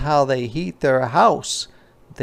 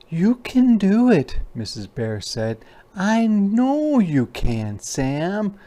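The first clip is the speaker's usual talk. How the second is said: mama bear, much higher, slow and gentle; encouraging mama bear; 9 semitones higher than usual. Acted. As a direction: cheer the cub on tenderly.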